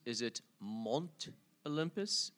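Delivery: slow, questioning "Mont", vowel as in father; Mont with short o. Shown as a demonstration. Guest offers host another reading, "Mont" said as mahnt. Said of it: "It's M O N T".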